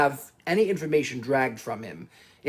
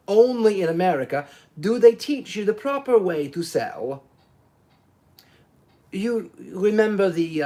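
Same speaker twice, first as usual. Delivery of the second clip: lightly voiced proud Italian, then groping for words; a hint of a boastful Italian faltering; voluble Italian salesman; 4 semitones higher than usual. Foscarelli boasting to Poirot, then recalling the case, lightly voiced Italian.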